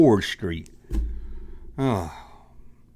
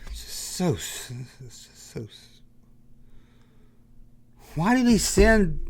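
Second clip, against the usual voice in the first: exasperated near-whisper; 2 semitones higher than usual. Real, not acted. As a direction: sputter out in disbelief. Exasperated, trailing off.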